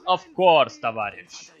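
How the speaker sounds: loud, booming Soviet officer; Soviet Commissar voice. Pretend. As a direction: answer like a proud commissar. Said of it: "glorious Soviet Commissar voice".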